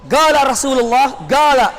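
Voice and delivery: "Gala" opened on a hard g instead of q; Yemeni g. Shown as a demonstration. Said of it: "yang dari Yaman".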